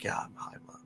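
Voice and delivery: voiceless, very soft reenacted admission; whispered confession. Son caught high by his mom, whispered confession.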